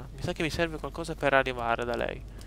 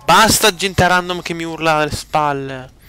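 loud, slow exasperated outburst; frustrated shout; 3 semitones higher, 9 dB louder, slower than usual. Real, not acted. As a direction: yell it out, fed up.

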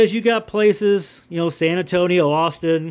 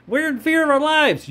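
high-pitched mock panic; mock fear; 10 semitones higher than usual. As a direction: put on a scared voice to ridicule them. Mimics frightened people, mocking them.

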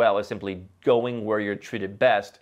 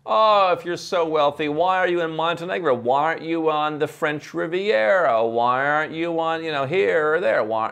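mocking critics; 7 semitones higher, 4 dB louder than usual. Critics mocking the wealthy vlogger's choice of Montenegro, mock incredulous. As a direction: needle him like a sceptical critic. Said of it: "criticized by some folks".

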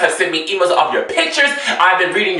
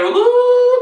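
delighted ooh. Real, not acted. Admiring the look on screen.

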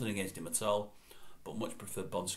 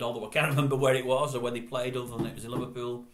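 normal talking voice

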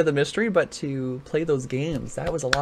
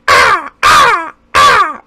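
loud high cawing crow calls; 14 dB louder, much slower than usual. Pretend. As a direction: caw out like a crow. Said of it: "that's more like a crow".

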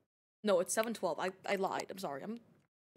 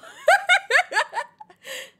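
high, loud cackling laugh; uncontrolled laughter; 13 dB louder than usual. Real, not acted. Streamer cracking up at own joke, high laughter. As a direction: burst out laughing for real.

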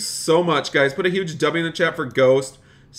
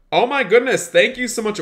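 high, louder burst of thanks; amazed gratitude; 9 semitones higher, 5 dB louder than usual. Real, not acted. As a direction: gush at a surprise donation. Reacting to a donation.

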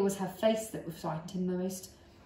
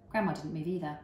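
usual speaking voice

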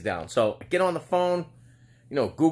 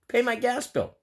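ordinary talk, nothing marked

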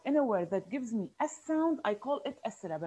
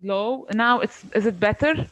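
louder, checking they can hear; raised voice; 9 dB louder than usual. Real, not acted. Teacher to student who can't hear, raised voice. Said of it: "Your voice is low".

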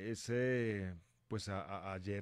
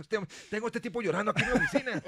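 higher and louder exasperated rant, breaking into laughter; mock outrage; 12 semitones higher, 10 dB louder than usual. A boss phoning to complain about the crying man, mock angry through laughter. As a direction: scold like a boss who can't stop laughing. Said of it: "me llama Fernando".

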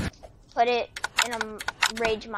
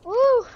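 high celebratory woo; 12 semitones higher, 5 dB louder than usual. Real, not acted. Celebrating a win.

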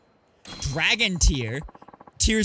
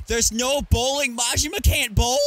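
loud, higher-pitched disbelief; incredulous; 5 semitones higher, 4 dB louder than usual. Real, not acted. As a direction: exclaim it in disbelief, rising to a question. Indignant surprise at missing bowling.

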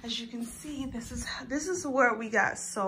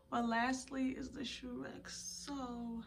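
reluctant; 15 dB quieter than usual. Real, not acted. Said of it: "I really don't feel like doing this".

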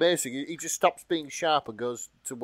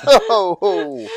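laughing oh; 6 semitones higher, 11 dB louder than usual. Real, not acted. Reacts to the sex-drive line.

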